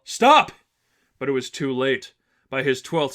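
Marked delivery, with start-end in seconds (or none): 0.0-0.5 sudden high, loud yell. Acted. Angel yelling at the seraphim to stop, high and loud. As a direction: shout it to stop him. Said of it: "tried to yell".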